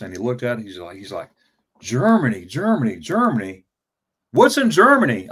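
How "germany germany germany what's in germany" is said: mock each repeat, then burst out louder; imitating an exasperated army superior; swooping repeats building to a high, loud demand; mocking incredulity. Retold superior mocking his dream sheet.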